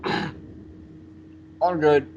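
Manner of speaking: strained choking voice; forcing words out while choking. Real, not acted. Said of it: "you're choking now".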